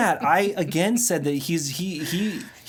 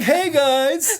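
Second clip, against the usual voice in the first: cheery; 12 semitones higher, 6 dB louder than usual. Retold or imitated.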